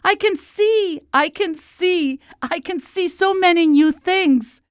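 very high, joyful princess cry; high-pitched jubilant exclamation; delighted shouting. A narrator's reading. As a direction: cry out like an overjoyed little girl.

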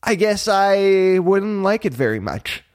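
put-on high boyish voice with mild reluctance; sighing reluctance. A narrator's reading. Pinocchio admitting his fault to Jiminy, sighing. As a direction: concede it with a touch of a sigh. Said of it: "Pinocchio sighed".

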